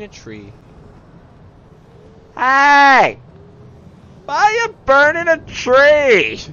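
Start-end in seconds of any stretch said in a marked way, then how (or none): 2.3-6.4 loud, high Australian holler; Australian accent. Pretend. Gamer challenges tree burners, shouted Australian accent. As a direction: yell it in a hammed-up Aussie accent. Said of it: "accidentally turned on my Australian accent".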